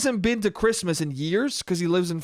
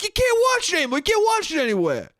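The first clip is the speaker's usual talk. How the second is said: mock indignant shout; 12 semitones higher than usual. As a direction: declare it like outrage. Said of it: "He's like".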